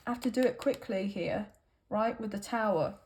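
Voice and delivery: usual speaking voice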